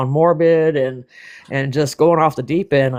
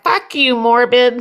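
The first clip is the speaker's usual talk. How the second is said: mock snappy insult; 9 semitones higher, slower than usual. Retelling a sudden insult.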